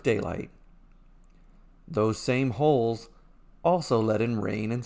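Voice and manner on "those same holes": ordinary delivery, nothing marked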